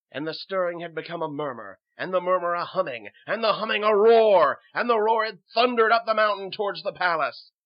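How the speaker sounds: storytelling swelling to a loud, high roar; mounting, thunderous crescendo; building narration. A narrator's reading. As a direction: build steadily from telling to near shout.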